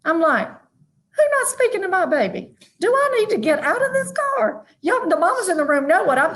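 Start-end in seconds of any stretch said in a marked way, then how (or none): 0.5-4.6 high, indignant mama bear, pitched well up; ready to jump out of the car for her baby; protective mama. Retold or imitated. Mama in the car defending her son, high and indignant.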